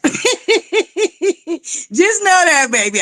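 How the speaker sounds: high laughing repeat. Real, not acted. Teasing a guest while laughing on live. Laughing echo of own words.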